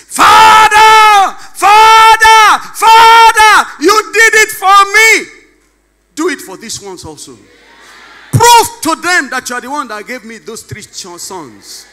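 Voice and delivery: loud, high cry to God; impassioned prayer cry. Acted. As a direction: plead loudly to the Father.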